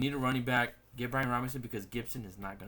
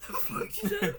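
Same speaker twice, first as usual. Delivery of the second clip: high strained laugh, throat still raw. Real, not acted. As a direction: laugh in disbelief at the choke. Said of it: "went down the wrong pipe".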